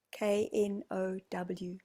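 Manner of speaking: each letter said slowly and apart: "K N O W"; spelled out. Shown as a demonstration. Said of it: "your true know".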